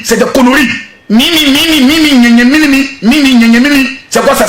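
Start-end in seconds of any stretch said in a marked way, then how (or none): 1.1-4.0 mock the word "mimi" like a whining child; mocking baby talk. Shown as a demonstration. Commentator mocks the singer's "mimi" as childish baby talk. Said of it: "Ça, c'est de l'enfantillage".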